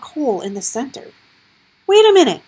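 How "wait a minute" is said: high, loud alarmed cry. A narrator's reading. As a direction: cry out in disbelief. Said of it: "Gregor cried".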